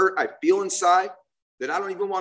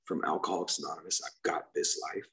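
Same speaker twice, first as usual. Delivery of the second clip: awed whisper; 10 semitones lower, 10 dB quieter, slower than usual. Retold or imitated. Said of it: "just go".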